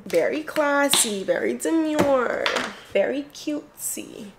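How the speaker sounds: airy, slow and high, mock-refined influencer; parodying the very demure trend, high and slow; TikTok demure voice. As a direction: draw out each word like a prim TikTok trend. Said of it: "we start talking like this".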